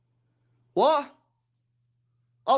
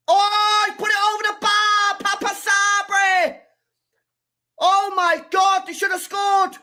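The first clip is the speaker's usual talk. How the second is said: disbelieving shout; 7 semitones higher, 5 dB louder than usual. Real, not acted. Fan reacting to a missed chance, shouting in disbelief. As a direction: yell it in real disbelief, stretching the words.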